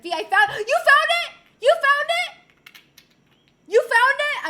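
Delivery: loud, high delighted cheering; excited cheer. Real, not acted. Streamer cheering a viewer who found it, thrilled. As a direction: celebrate the viewer's find at full volume.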